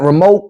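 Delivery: "remote" ending on a hard K. Retold or imitated. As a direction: say "remote" as ree-moke. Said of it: "when we got a K in remote".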